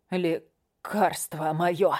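very slow, rasping gasp; wheezing. Acted. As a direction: wheeze the words out, struggling. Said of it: "прохрипела цыганка".

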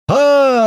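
loud, very high, drawn-out scream; joyful yell. A narrator's reading. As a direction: scream back with joy. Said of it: "орал Лёха".

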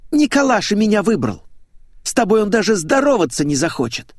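high, loud gloating jab; taunting boast. Acted. Young woman boasting to her rival about a suitor, taunting. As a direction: flaunt the victory at your rival.

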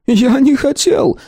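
high, slow, frightened stammer; stammering in fear. A narrator's reading. Beaten shopkeeper stammering to his attackers, high and scared. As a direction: stumble over the words, scared stiff. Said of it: "пробормотал лавочник, заикаясь".

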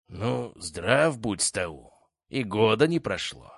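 slow lisping stranger's greeting; slushy sh for s. A narrator's reading. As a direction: lisp through a ruined mouth. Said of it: "его шепелявый голос".

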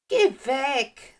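put-on high, loud ghost girl; Geistermädchen. A narrator's reading. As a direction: send them away as an upset girl ghost. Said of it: "sagte der Geist". (hohe, laute Geistermädchenstimme)

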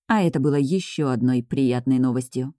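plain reading voice

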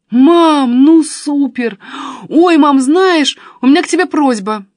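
high, loud gushing delight; excited. A narrator's reading. Daughter thrilled, then asking.

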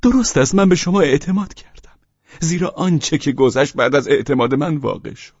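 high, loud, eager; eager Pavel Pavlovich. Acted. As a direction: rush to agree.

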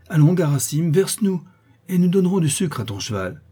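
high, loud, coaxing call to the coachman; eager plea. Acted. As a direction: egg the driver on, bright and giddy. Girl begs coachman to tip sleigh.

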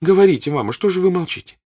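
high, loud demand; insistent daughter. Acted. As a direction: daughter urging her silent mother to speak. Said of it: "сказала она, оглядываясь на мать".

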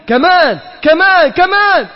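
escalating mock prodding. Squeezing harder, building.

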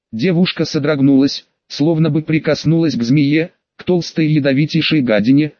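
ordinary delivery, nothing marked

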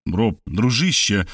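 ordinary delivery

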